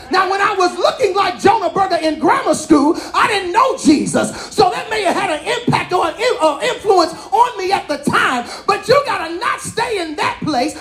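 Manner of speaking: climbing sermon shout, almost a chant; full-force preaching. Acted. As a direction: preach it out to the whole church.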